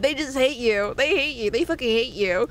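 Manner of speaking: ordinary delivery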